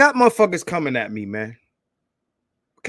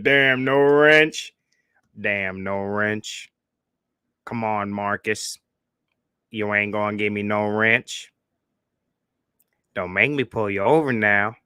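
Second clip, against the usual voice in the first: Officer Charles voice; 5 semitones lower than usual. Retold or imitated. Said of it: "This is my Officer Charles voice".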